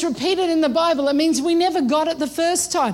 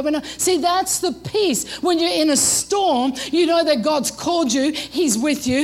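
normal talking voice

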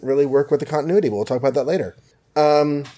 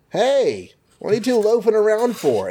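much higher, breezy hero voice; Tony voiced; 10 semitones higher than usual. Host voicing Tony catching his staff loafing, high and breezy. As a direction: play Tony strolling in like nothing happened.

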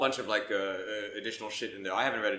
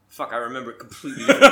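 laughing; 15 dB louder, faster than usual. Real, not acted. Repeats line laughing, louder.

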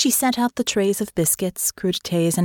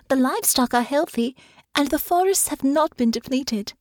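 put-on higher, earnest young woman; sincere reassurance; 7 semitones higher than usual. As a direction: reassure him tenderly. Honor reassures father.

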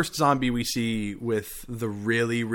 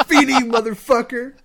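high, louder furious cry; mock yell; 12 semitones higher, 7 dB louder than usual. Retelling Robinson cursing the approaching zombie, high loud mock yell. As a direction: throw the curse at the zombie full volume. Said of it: "He goes".

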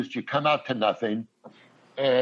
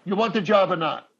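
blunt manager; 6 semitones higher than usual. Retold or imitated. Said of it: "he goes".

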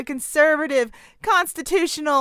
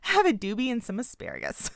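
breaking up with laughter, softer; laughing; 6 dB quieter than usual. Real, not acted. Host cracks up at his own stoner joke, laughing softly. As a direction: chuckle through the line. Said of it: "laughing at his own joke".

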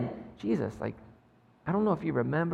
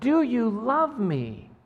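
tender question; 5 semitones higher, much slower than usual. Acted. Jesus asks Peter gently.

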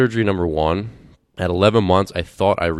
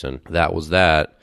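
usual speaking voice

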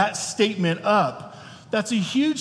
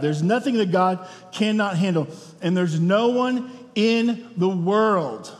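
ordinary delivery

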